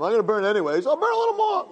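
rising to a very high, sneering voice; mocking evil inclination. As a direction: jeer the excuse like a taunting tempter. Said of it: "Yetzer Hara says it all the time".

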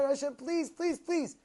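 high, repeated plea; mock tearful begging. Crying son begs his father, acted plea. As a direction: imitate a child pleading to a father. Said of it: "they just cry and cry".

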